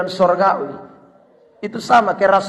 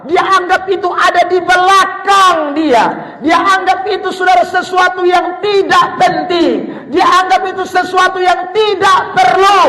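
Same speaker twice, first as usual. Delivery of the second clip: high, raised, sustained preacher's pitch; forceful preaching; 7 semitones higher, 3 dB louder than usual. Acted. Preacher to congregation on what Paul dismissed, forceful preaching. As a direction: drive each phrase home from the pulpit.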